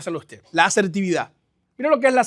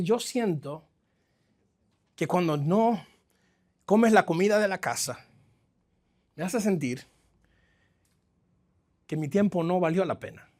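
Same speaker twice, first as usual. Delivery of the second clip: softer, gentle "yo siento" statement; calm assertive example; 4 semitones lower, 7 dB quieter than usual. Shown as a demonstration. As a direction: model a calm, honest feeling. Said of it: "Aquí puedes decir".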